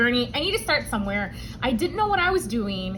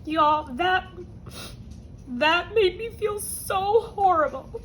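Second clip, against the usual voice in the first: tearful, higher-pitched voice; real crying; 7 semitones higher, slower than usual. Real, not acted. Vlogger telling viewers how the vet bill felt, crying. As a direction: break into tears while confessing. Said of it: "doesn't like it when I cry".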